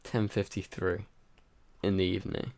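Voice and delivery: normal talking voice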